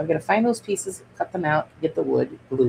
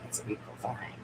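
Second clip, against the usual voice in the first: a hushed, admiring whisper; 8 semitones lower, 16 dB quieter than usual. Shown as a demonstration. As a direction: whisper "It's a beautiful thing" softly to yourself.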